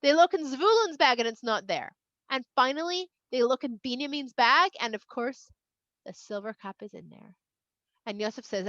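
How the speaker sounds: usual speaking voice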